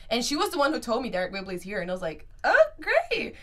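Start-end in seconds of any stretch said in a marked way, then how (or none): 2.4-3.3 high, bright-eyed "oh great" burst; excited exclamation. Retold or imitated. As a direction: squeal it out with delight. Excited reaction to meeting news.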